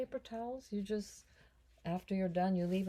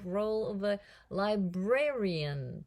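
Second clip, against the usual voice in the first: ordinary talk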